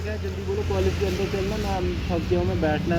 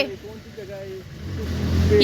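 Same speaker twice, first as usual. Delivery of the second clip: puzzled; 12 semitones higher, much slower than usual. Real, not acted. Asks where they are.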